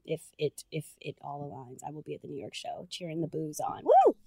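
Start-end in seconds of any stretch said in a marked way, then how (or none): 3.8-4.1 high, loud cheer. Pretend. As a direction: whoop like at a live show. Cheering the boos on.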